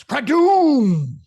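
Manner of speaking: crash sound effect. Acted. Co-host voicing the shield hit, loud crash effect. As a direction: bellow the comic crash like an explosion.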